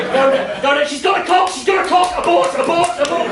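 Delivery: abort like the picture's gone wrong; hammed-up alarm at the screen; mock panic. Panicked dial-up viewer.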